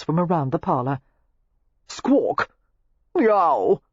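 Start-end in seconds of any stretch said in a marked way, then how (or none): 1.9-2.5 mock squawk. Practice animal calls. 3.1-3.8 loud, higher cat yowl; mock cat yowl. Practice animal calls.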